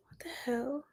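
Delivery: breathy, muttered under her breath; whispered swear. Real, not acted. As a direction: hiss it to yourself in annoyance. Frustrated while searching Twitch settings.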